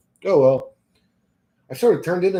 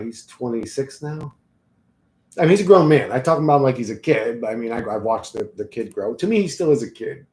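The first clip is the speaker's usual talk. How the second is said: ordinary delivery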